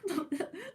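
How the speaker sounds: short high laugh; bright little laugh; high giggle. Real, not acted. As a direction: giggle at yourself. Laughing at own reaction.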